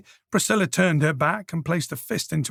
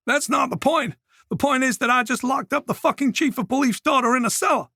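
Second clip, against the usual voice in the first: panicked; 10 semitones higher than usual. A narrator's reading. Mason panicking.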